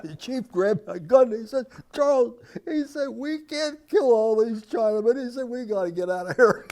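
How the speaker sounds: high, loud, laughing retelling. Real, not acted. Veteran quotes his chief after the rock hit, laughing. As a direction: tell it through helpless laughter.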